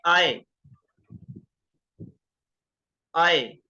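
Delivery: "आए" said as a clear gliding ai sound; diphthong sound. Shown as a demonstration. Teacher showing students the ai diphthong, slow and clear. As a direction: stretch "आए" from a to i. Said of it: "जिसे हम बोलते हैं".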